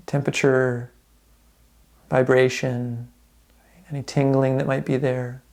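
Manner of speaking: calm, unhurried meditation guide with long pauses; guided meditation. Real, not acted. Instructor guiding listeners through a body scan, calm guided meditation. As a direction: ease them gently through a body scan, leaving space between phrases.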